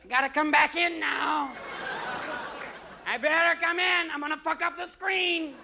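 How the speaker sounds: whining, insistent cat threat; demanding cat. Acted. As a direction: draw each phrase out in a high yowl. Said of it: "to come home at six o'clock in the morning going".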